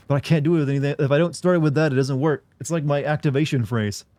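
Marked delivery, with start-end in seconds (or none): none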